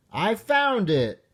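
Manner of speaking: high, called-out "I found it"; cheerful yell. Acted. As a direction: shout it from inside the house. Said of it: "yelled Froggy".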